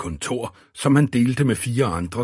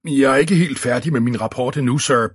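raised, louder flustered babble; babbling; 4 semitones higher, 5 dB louder than usual. A narrator's reading. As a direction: babble excuses to the boss. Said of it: "bablede Eddie løs". (bablende, med hævet stemme)

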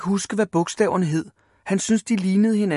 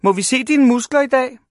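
high, loud taunting question; teasing; 9 semitones higher, 7 dB louder than usual. A narrator's reading. Big boys to little Ivan about muscles, taunting. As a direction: taunt him like a school bully.

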